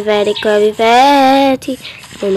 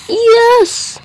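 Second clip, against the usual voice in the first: very high joyful yes; triumphant cry; 12 semitones higher, 4 dB louder than usual. Real, not acted. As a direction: cheer your escape.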